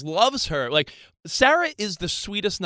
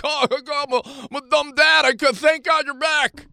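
played-up dumb dad; 7 semitones higher than usual. Husband playing helpless for his wife, hammed-up dumb dad. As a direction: ham up the clueless gratitude, pitched high. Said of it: "do I have to play it up like".

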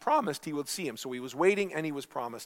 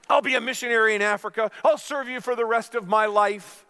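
high, loud rushed pleading; pleading promises; 5 semitones higher, 5 dB louder than usual. Acted. Jack on the branch pleading with God, high and urgent. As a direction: bargain desperately, piling up promises.

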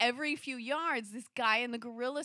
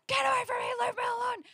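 high, loud panicked shriek, acted out; high-pitched mock terror; retold terrified scream; 13 semitones higher, 5 dB louder, much faster than usual. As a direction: re-enact screaming at a pursuer to back off. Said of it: "just screaming, like, blood-curdling screams".

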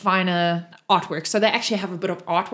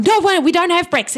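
high, loud protest; shouted from above; shouting down; 9 semitones higher, 7 dB louder than usual. Acted. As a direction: yell down in protest. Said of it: "Lukiel shouts down".

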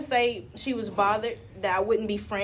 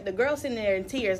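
ordinary delivery

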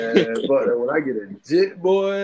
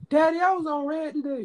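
put-on high-pitched little kid voice; child confessing to daddy; 12 semitones higher than usual. Voicing a child confessing to father.

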